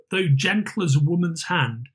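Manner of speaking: plain reading voice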